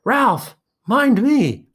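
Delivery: very high, loud panicked scream; terrified scream. A narrator's reading. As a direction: scream a warning in terror. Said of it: "Piggy screamed".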